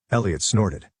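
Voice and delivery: ordinary delivery, nothing marked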